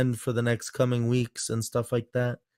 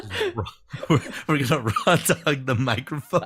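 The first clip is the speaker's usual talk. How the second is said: laughing; 5 semitones higher, 3 dB louder than usual. Real, not acted. Laughing at own joke.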